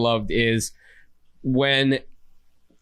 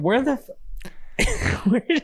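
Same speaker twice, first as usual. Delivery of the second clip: mock disbelief; 8 semitones higher than usual. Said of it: "And you're like".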